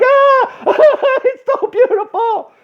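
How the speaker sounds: very high, squealing fake sob; hammed-up happy crying; mock tearful bawling. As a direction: blubber it, overcome and over the top. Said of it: "I had tears coming out".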